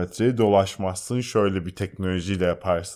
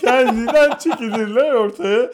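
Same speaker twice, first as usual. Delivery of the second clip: high, loud, cracking up; laughing mock amazement; 12 semitones higher, 5 dB louder than usual. Real, not acted. Co-host joking about lottery balls flying out by themselves, high, loud, laughing.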